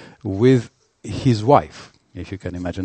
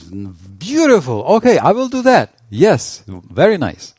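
much higher and louder, beaming approval; delighted agreement; 4 semitones higher, 7 dB louder than usual. Acted. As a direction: cheerfully grant the wish with a big lift in the voice. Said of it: "And Chaitanya Mahaprabhu says".

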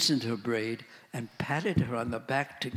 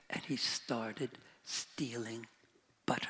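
hushed whisper; 7 dB quieter than usual. A narrator's reading. Storyteller whispering to the listeners. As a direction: whisper it like a secret.

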